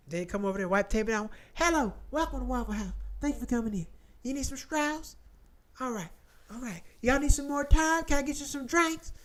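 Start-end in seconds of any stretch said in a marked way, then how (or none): 1.5-9.1 put-on Southern accent, friendly waitress; higher-voiced Southern server. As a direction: play a Southern diner server. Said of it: "they got the Southern accent".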